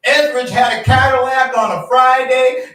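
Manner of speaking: high, loud barroom hawker's call; old geezer hawking. Retold or imitated. Old geezer at the bar hawking a legend, loud and high. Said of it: "some old geezer hawked one night".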